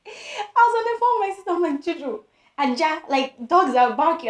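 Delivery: high, loud voice cracking up with laughter; laughing. Real, not acted. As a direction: retell the panicked call through helpless laughter. Reliving panicked phone call while laughing.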